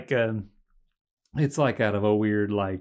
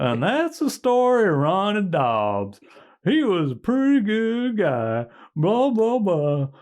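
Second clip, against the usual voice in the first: hillbilly narrator; 11 semitones higher, 5 dB louder, slower than usual. Retold or imitated. Brain in jar narrates ending.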